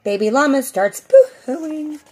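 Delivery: usual speaking voice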